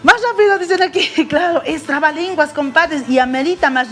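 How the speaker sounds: excited. Real, not acted. Excited host relays request.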